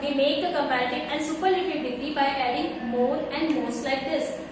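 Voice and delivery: normal talking voice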